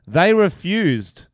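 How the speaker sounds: slow, loud outrage; indignant. Real, not acted. Outraged punchline.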